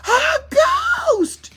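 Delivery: very high, loud terrified scream; wild shriek. Acted. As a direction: scream in pure panic. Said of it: "shrieked Tommy wildly".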